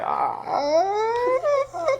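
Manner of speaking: hammed-up high wail, half laughing; mock cry. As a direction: let out a fake sob, very high. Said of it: "I'm finna cry".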